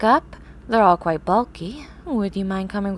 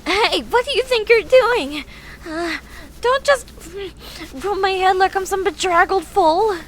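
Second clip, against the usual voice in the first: much higher, louder indignant squeak; flustered protest; 11 semitones higher, 3 dB louder than usual. Acted. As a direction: yelp in embarrassed outrage. Trainer rubs her head dry.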